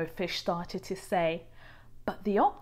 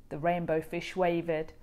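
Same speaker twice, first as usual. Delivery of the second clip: plain reading voice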